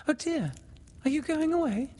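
put-on very high girl's voice; dismayed girl. A narrator's reading. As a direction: ask high, dismayed at the parting. Windy voiced at parting.

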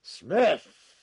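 sharp, high shout of a name; very loud, commanding bellow; yelled command. A narrator's reading. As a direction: yell it like a guard over a loudspeaker. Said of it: "yelled a voice from the telescreen".

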